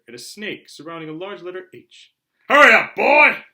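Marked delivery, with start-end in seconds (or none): 2.5-3.4 booming much louder shout. Acted. Uncle Vernon calling Harry from the kitchen, a loud shout. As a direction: bellow it across the house. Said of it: "shouted Uncle Vernon".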